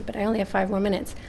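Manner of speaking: ordinary talk, nothing marked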